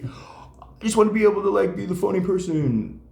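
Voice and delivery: high, loud whiny student; mock student voice. Students telling their comedy teacher, in a high, whiny mock voice.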